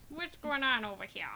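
put-on Chicago cop accent. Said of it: "I'm a cop from Chicago".

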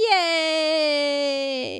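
long drawn-out mock "yay"; mock group cheer. Everybody loving his campaign pitch, mock cheering. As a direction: cheer like a whole delighted office, hammed-up. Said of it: "Everybody's like".

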